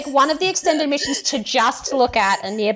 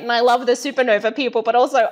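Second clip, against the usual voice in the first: normal talking voice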